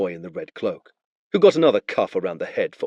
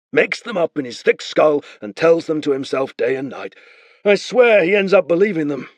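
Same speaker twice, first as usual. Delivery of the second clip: easy higher false friendliness; Beren bluffing; 7 semitones higher, 4 dB louder than usual. Acted. Beren casually bluffs the spearman to free the boy. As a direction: talk the soldier round.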